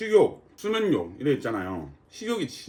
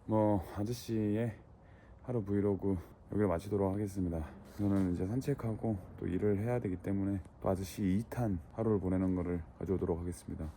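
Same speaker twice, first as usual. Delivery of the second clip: quiet sign-off; 7 semitones lower, 9 dB quieter than usual. Real, not acted. Vlog sign-off.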